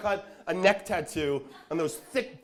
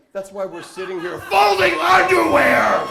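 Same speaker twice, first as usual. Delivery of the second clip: higher, louder, slow shout; mock outburst; 10 semitones higher, 13 dB louder, slower than usual. Imagined husband yelling back at wife, loud slow shout. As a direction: blow up at her.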